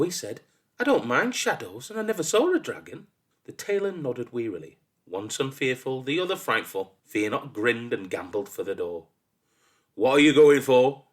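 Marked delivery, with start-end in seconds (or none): 0.8-3.1 put-on high, quick boyish protest; piping up eagerly. A narrator's reading. Son assuring father he fears no dragons, piping up. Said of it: "piped up". 9.9-11.0 loud, higher-pitched quizzing; testing father. A narrator's reading. Father testing his forgetful son on the errand, loudly. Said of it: "tested his father".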